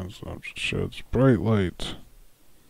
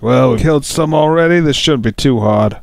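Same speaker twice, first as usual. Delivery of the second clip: loud, cocky and higher; Brad confident; 6 semitones higher, 10 dB louder than usual. Acted. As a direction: brush the fight off, loud and sure. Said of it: "Brad just like".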